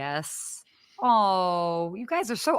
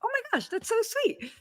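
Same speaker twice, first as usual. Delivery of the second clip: much higher, squealed delight; surprised delight; 4 dB quieter than usual. Retold or imitated. Host reliving her award surprise, high delighted squeal. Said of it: "I'm like".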